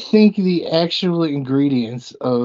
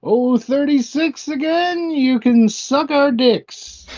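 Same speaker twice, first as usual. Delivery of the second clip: bingo caller; 9 semitones higher than usual. Acted. Repeats partner's bingo rhyme.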